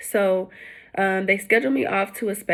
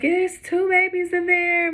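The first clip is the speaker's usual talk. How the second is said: excited news; 11 semitones higher, slower than usual. Retold or imitated. Texting mom the news.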